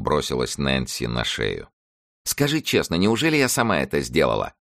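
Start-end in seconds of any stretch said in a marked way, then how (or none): 2.2-4.5 put-on excited young girl. A narrator's reading. Excited girl asks Nancy.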